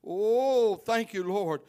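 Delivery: usual speaking voice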